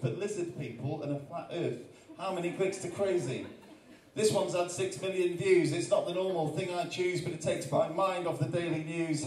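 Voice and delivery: rhythmic spoken word with a repeated question hook; performed poem. Acted. Poet reciting his YouTube poem to the crowd, rhythmic. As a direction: recite it with a steady beat.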